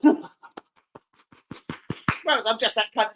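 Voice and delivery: ordinary talk, nothing marked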